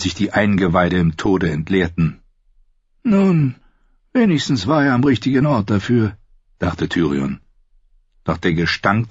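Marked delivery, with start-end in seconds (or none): 3.0-6.2 higher voiced bitter son, probing his father; a higher, defiant son testing his father; Tyrion's voice. Son asking his father about punishment, higher defiant voice.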